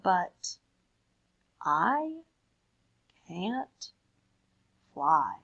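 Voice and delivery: each word set apart in a slow, measured read; slow storybook poem voice. Acted.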